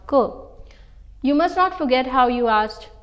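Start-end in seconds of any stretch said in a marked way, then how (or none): 0.1-0.2 "que" with a k sound; hard c. Shown as a demonstration. Teacher showing the wrong cédille reading, "que" with hard k. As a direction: say "que" as a short hard k syllable. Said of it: "and not".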